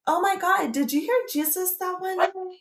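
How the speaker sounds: high, eager gush of a question; excited question. Retold or imitated. Retelling asking the cafe owner, eager and high. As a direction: blurt it out, thrilled to ask. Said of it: "And I was like".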